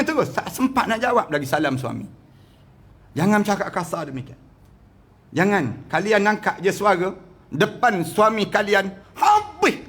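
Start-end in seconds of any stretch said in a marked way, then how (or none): none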